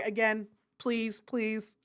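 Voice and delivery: usual speaking voice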